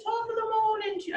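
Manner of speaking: high, quick mock English accent; a cheery English greeting, lightly put on. Teacher voices the monocyte with a mock English accent. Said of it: "I don't know why he's English".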